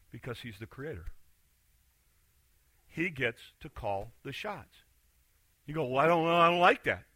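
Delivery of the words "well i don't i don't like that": griping loudly at God about fairness; loud complaint. Pretend. Congregant complaining to God that life is unfair, loud gripe. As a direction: protest that it's not fair, voice raised.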